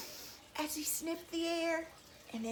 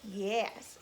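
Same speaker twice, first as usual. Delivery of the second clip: ordinary delivery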